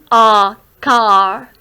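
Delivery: "car" with a long, drawn-out A; lengthened vowel. Shown as a demonstration. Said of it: "you have to lengthen this sound". ("car" में A को लंबा खींचकर)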